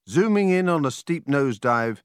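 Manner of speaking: usual speaking voice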